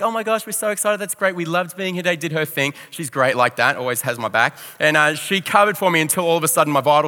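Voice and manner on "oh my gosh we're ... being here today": high-pitched, quick-to-the-rescue gush; gushing excitement. Retold or imitated. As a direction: imitate an over-keen thank-you. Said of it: "So Rach jumps, she's like".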